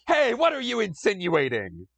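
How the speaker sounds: high, indignant comic-strip yell; Calvin yelling. Acted. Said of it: "Calvin is gonna yell, then I'm gonna yell".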